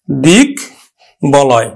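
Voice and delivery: দিক and বলয় set apart with pauses, ব fully sounded; syllable by syllable. Shown as a demonstration. Teacher sounding out দিক বলয় for students. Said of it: "আমরা উচ্চারণ করব".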